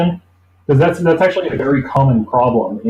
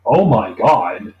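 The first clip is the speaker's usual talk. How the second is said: slow, drawn-out "Oh my God"; stunned disbelief; 2 semitones lower, much slower than usual. Retold or imitated. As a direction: let the shock sink in slowly. Shocked at sixteen a week.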